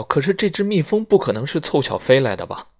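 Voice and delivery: high, loud, agitated question; young woman calling out her fear to the group; crying out. Acted. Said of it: "薇拉喊道".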